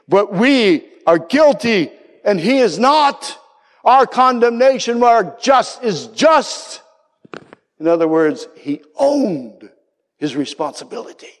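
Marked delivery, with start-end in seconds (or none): 0.1-6.8 high, slow pleading confession; thief crying out. Retold or imitated. Thief confessing guilt to the other thief, crying out. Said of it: "he cries out to him".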